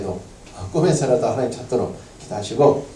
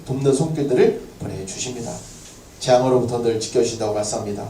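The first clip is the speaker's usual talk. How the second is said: calm prayer; 6 dB louder than usual. Acted. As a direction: settle back into thankful prayer. Closing prayer after amen.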